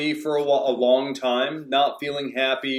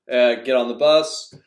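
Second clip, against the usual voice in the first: ordinary talk, nothing marked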